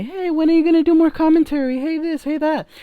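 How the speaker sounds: high-pitched mock falsetto of nagging viewers; pestering fans. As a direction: squeal it as a mocking imitation of fans. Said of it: "a couple of you have been asking me".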